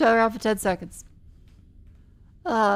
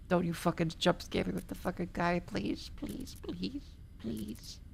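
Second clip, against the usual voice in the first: hushed frightened pleading; scared horror game streamer; 3 semitones lower, 12 dB quieter than usual. Real, not acted. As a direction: beg under your breath, terrified.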